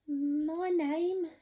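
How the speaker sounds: high, wobbly little cook voice; timid cook. Acted. Storyteller voicing the nervous cook, high and timid. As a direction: squeak it out like a nervous wimp.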